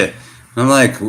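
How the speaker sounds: normal talking voice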